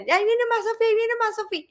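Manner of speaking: high, hushed-alarm imitation of children; kids' warning cry. Children at play warning of grandma, high mock alarm. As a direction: pass the warning along in a high voice. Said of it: "entonces decían".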